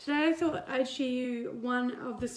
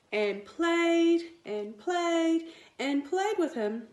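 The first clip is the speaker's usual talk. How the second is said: slow, stretched and higher; drawn-out playing; 7 semitones higher, 4 dB louder, much slower than usual. A narrator's reading. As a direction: stretch each played out longer.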